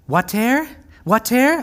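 put-on high, rising French-accented "wa-tair?"; French-accented toddler cry. Preacher imitating Penny at the window with watering can, high French accent. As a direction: ask it in a high mock French accent, pitch sliding up. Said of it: "she has a French accent".

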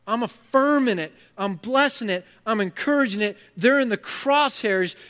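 loud, impassioned sermon climb; preaching at full force. Acted. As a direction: drive the point home to the crowd.

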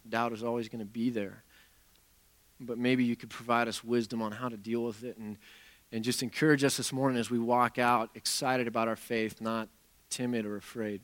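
soft, quiet prayer voice, falling at phrase ends; opening prayer. Acted. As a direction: pray gently and humbly to God. Opening prayer before sermon.

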